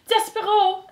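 high, loud theatrical mother's cry; calling out. A narrator's reading. Antoinette calling to her condemned son, crying out. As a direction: cry the name out high and dramatic. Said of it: "Antoinette called out".

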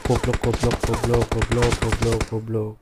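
low muttered repeated curses; cursing under breath. Real, not acted.

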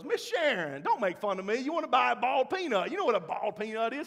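high, loud, hurt Cajun protest; Cajun accent. Retold or imitated. Pastor imitates Cajun man insisting to his mom, Cajun accent. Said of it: "because of his accent".

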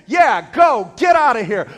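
loud, punched banishing order; shouted dismissal. Retold or imitated. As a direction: drive them out with a shout. Acts Jesus casting demons out.

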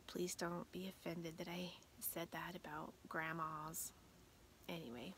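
soft, guilty whisper; whispered apology. Real, not acted.

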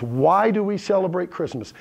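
ordinary talk, nothing marked

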